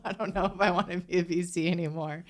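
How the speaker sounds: put-on quick, laughing through her own old doubt; laughing self-quote. Host to guest, retelling her own doubt, laughing. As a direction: giggle as you blurt out the change of heart. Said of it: "And then I was like".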